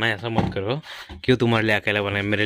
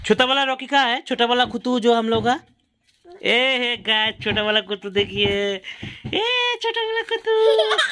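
excited puppy calling; 11 semitones higher than usual. Real, not acted. Father finds the little puppy for viewers, high excited calling.

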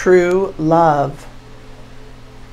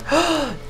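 surprised gasp; 8 semitones higher, 6 dB quieter than usual. Real, not acted. Gasp at death card.

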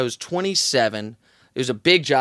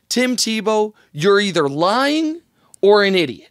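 over-the-top, higher-pitched radio rant; impassioned monologue; 4 semitones higher than usual. Retold or imitated. Radio host denouncing Tim Tebow on air, impassioned. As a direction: drag out the accusation, pitched up. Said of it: "one of my many impassioned, maybe slightly over the top".

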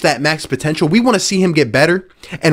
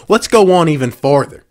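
ordinary talk, nothing marked

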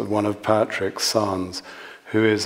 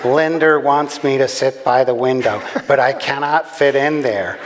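put-on American accent, aggrieved and panting; panting American woman; 3 semitones higher than usual. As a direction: complain like a breathless American passenger. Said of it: "she panted".

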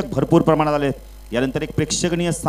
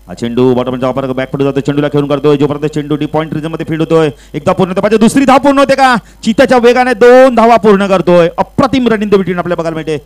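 excited play-by-play; 3 semitones higher, 7 dB louder than usual. Real, not acted. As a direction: ride the quick running with rising excitement. Live play-by-play building to peak. (चढत्या आवाजात उत्साही समालोचन)

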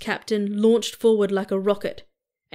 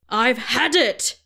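slow, higher furious yell; 4 semitones higher, slower than usual. A narrator's reading. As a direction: bellow it at the top of your lungs. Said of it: "the captain yelled out at the top of his voice".

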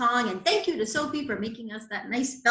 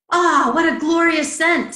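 louder, higher swoon of delight; rapturous dragonfly; 6 semitones higher, 10 dB louder than usual. A narrator's reading. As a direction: gush over a lovely smell. Dragonfly delights in the lilies' scent.

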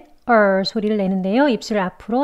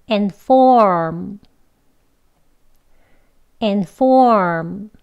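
"And form" with loud, long "form"; careful, emphatic stress on "form"; strong form; 3 dB louder, much slower than usual. Shown as a demonstration. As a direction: punch and lengthen "form" after a clear "And". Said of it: "강하고 높게 길게 발음하셔서".

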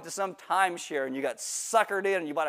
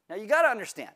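ordinary delivery

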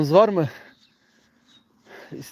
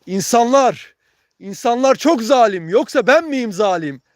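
shouting; 6 semitones higher, 5 dB louder than usual. Real, not acted. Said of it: "Ben arada bağırıyorum".

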